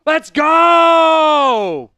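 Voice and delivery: loud, high victory yells; fired-up shouting. Real, not acted. Streamer celebrating Elite Eight trip, fired-up shouting. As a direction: celebrate like you just won it all. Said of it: "I'm fired up".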